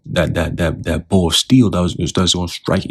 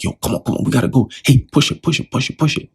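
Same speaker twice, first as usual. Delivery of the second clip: acting out hurried pushers, pitched up; high, pressing mock urgency; urgent hustling; 7 semitones higher than usual. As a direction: hustle them along in quick repeated bursts. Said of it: "they're trying hard, quick, like".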